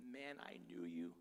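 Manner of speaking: put-on God speaking tenderly to his beloved child. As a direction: speak as a loving father whispering to his child. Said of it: "God looks at you and goes".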